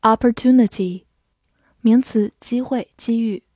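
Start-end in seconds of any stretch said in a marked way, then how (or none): 0.0-1.1 "opportunity" in an American accent, open "ah" at the start; American pronunciation. Shown as a demonstration. Teacher shows learners the American version, "opportunity" in American pronunciation. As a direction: say "opportunity" the American way, AH-per-TOO-nity. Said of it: "美式发音".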